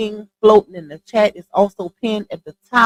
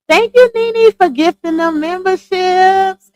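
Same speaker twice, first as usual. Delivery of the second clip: high, loud gushing thank-you; excited thanks; 10 semitones higher, 7 dB louder, slower than usual. Real, not acted. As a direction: burst out grateful for the gift. Thanking a gifter on stream.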